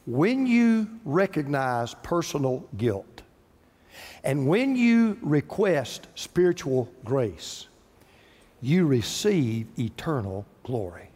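each phrase slow, with pauses between; slow emphatic preaching. Real, not acted. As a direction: lay it down slowly, phrase by phrase. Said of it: "this is my favorite part".

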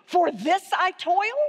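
mock-incredulous, asking it back; sarcastic question. Shown as a demonstration. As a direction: scoff at it as a question. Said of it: "So try this with me".

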